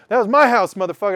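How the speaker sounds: loud, high furious homeowner shout; imagined angry yell. Pretend. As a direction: yell it at the guy who wronged you. Imagined victim confronting him on stage.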